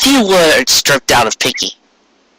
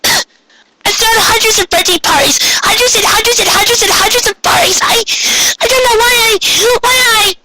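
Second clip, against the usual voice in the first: high, tearful, near shouting; distraught Pinkie. A narrator's reading. Pinkie breaks down over her failed party, high and crying. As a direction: cry it out in a high pony voice.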